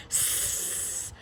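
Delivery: soft drawn-out hiss; rats hissing. A narrator's reading. Rats hissing at the mouse, soft long hiss. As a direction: hiss through the teeth. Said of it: "hissed the other rats".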